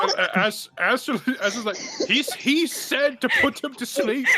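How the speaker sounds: high, loud, laughing through the words; cracking up while telling it. Real, not acted. Laughing retelling, excited.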